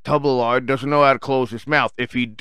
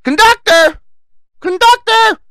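very high, loud mock squeal for help; voice turned bitch; 9 dB louder, slower than usual. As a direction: tough guy suddenly shrieking for the conductor. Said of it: "Then his voice changed".

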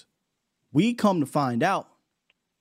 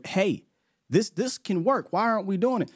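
higher, pleading mock voice; player pleading; 6 semitones higher than usual. Player publicly begging the coordinator, higher mock plea. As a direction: beg politely. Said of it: "publicly now".

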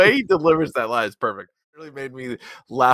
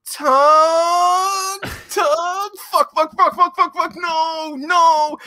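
high, panicked yelling; frantic high-pitched reenactment of a panicked hero; shrill mock panic over the wreck; frantic MacGruber; 13 semitones higher, much slower than usual. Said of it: "And him running over going".

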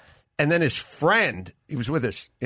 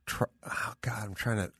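a breathy whispered oh God while searching for a name; whispered groan; 4 semitones lower, 12 dB quieter than usual. Real, not acted. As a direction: whisper it to yourself, stuck on a word. Struggling to recall a name.

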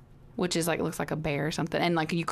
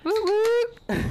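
excited; 5 dB louder than usual. Real, not acted. Cheering along.

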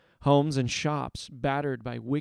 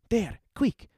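higher hurried whisper-quick urging; Zeri urging; 9 semitones higher than usual. Acted. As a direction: push the boy to hide. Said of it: "she said".

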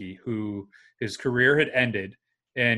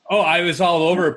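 excited; 6 semitones higher, 7 dB louder, faster than usual. Real, not acted. Recalls getting goalie tapes.

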